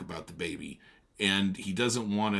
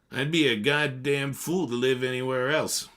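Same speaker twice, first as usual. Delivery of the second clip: loud, higher brusque interruption; gruff Tom; 5 semitones higher, 6 dB louder than usual. Acted. Tom interrupts Daisy to talk to Nick, brusque. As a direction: cut in hard. Tom's boastful line.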